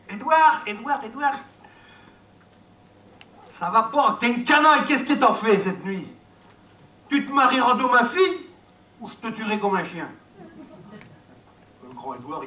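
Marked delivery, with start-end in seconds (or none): 0.1-1.4 shouting a name. Acted. Mayor yelling at Édouard from the window. 3.5-10.1 angry scolding. Acted. Mayor threatening Édouard from the window, angry.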